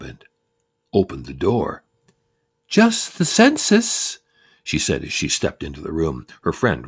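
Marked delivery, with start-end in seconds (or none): 2.7-4.2 high, slow, sing-song lady voice; a sweet, very high census taker announcing herself. Retold or imitated. Census woman at the door, high sweet voice.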